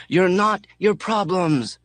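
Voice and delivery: high, slow, loud yell. A narrator's reading. Mechanic yelling back to the space monkey. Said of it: "The mechanic yells".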